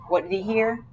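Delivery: ordinary delivery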